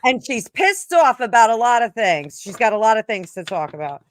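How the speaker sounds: put-on angry New Jersey accent, punched. Anna Miller shows up pissed off, loud New Jersey accent. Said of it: "Do the accent".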